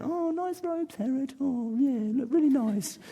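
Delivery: high, sarcastic jeer at the king; mocking crowd voice. Crowd mocking Herod's robes in a high sarcastic voice. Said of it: "They were mocking him".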